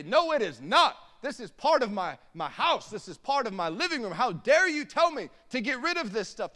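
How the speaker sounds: high, loud mock outrage; hammed-up defensive indignation; indignant denial. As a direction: protest as if your belongings are insulted. Acted defence of one's own trash.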